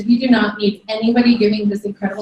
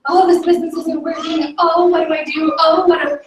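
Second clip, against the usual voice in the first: mock whine, pitched higher; higher-pitched complaining, put on; whining; 5 semitones higher than usual. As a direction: imitate a caller whining about their troubles. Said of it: "you're whining and whining".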